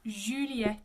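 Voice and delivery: Juliet as "zhü-lyet"; French U vowel. Shown as a demonstration. Teacher demonstrates the U for students, French pronunciation. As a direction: ee in the mouth, oo lips on Juliet. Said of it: "It's E in the mouth".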